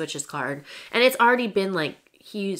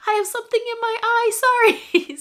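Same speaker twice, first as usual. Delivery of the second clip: much higher, louder put-on mom voice covering tears; imitating mom; 7 dB louder than usual. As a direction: play mom pretending it's just something in her eye. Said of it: "And she's like".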